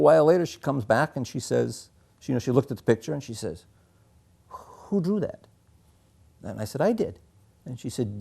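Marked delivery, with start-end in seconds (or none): 4.5-5.5 amazed nurse. Retold or imitated. 6.8-7.2 put-on small child. Small boy answers nurse.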